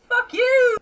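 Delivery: high, loud drawn-out shout; yelled insult. Acted. Murphy shouting at figures going away, yelled insult. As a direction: holler it after someone far off.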